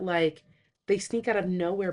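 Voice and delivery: ordinary talk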